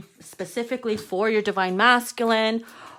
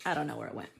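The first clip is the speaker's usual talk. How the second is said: ordinary talk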